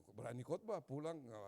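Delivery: ordinary talk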